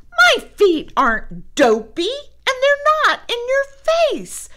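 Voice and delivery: shouting back. A narrator's reading. Sister shouting back at brother, high loud shout. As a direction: yell back indignantly. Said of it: "she shouted back".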